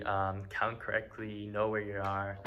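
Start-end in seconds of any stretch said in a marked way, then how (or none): none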